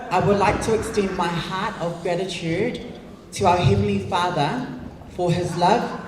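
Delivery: ordinary delivery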